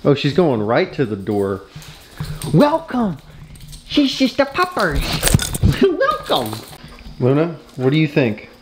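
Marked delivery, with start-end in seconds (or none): 2.5-6.6 very high, squeaky puppy-talk voice. Real, not acted. Owner gushing over her pupper, gushing baby talk.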